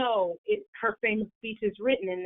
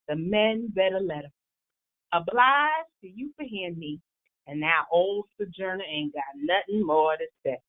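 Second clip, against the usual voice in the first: Sojourner Truth speech in dialect. Acted. Sojourner Truth addressing the women's rights convention, Southern dialect oration. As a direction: deliver the speech in a Southern dialect like a nineteenth-century orator. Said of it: "she spoke in that manner".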